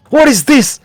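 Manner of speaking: high loud alarmed cry. Acted. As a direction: panic rising. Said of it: "Sergei stands up".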